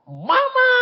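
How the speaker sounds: put-on very high, loud child's cry for mother; piercingly high acted call; child's shout. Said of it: "Anaknya teriak".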